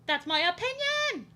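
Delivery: high, loud, drawn-out holler; mock-defiant yell. Defending their opinion about creators to viewers, mock-defiant yell.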